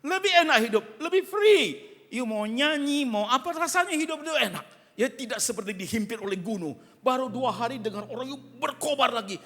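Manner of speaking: loud, high, pounding sermon delivery; full-force preacher calling out to the crowd; fiery preaching. Acted. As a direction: preach it hard to the congregation.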